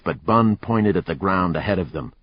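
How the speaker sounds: ordinary delivery, nothing marked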